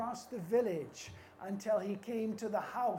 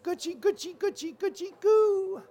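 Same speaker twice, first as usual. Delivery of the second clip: high coo building to a loud final goo; comforting baby talk; 8 semitones higher, 5 dB louder than usual. Acted. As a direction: coo playfully, louder as you go. Chief soothing baby again.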